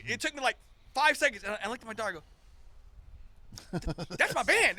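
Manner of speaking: shocked recognition. Retold or imitated. Retelling to friends how he spotted his band on the poster, climbing into excited disbelief. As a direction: let the realization burst out.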